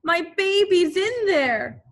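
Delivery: high, loud pleading panic; mother begging for her baby; frantic cry. Acted. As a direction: beg them to save the child. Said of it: "she screamed".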